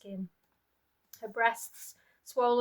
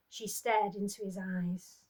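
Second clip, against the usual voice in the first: usual speaking voice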